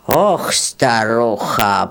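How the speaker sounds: low, slow reproachful sigh; weary old man. Acted. Grey-haired peasant reproaches the greedy beetle, low and slow. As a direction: shake your head at the old woman.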